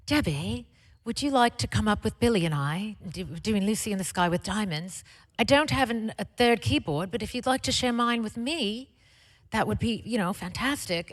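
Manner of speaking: hammy, half-hearted imitation of a British pop star; bad British accent. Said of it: "I'm gonna do a really bad British accent".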